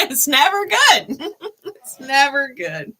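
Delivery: laughing through the words, high and loud. Real, not acted. Reader laughs at her own warning, laughing. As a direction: giggle as you repeat it.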